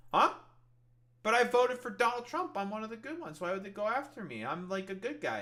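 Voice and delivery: high, hurt put-on innocence; mock bewildered voter. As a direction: plead like a wronged innocent, mocking. Mocks baffled, wronged voter.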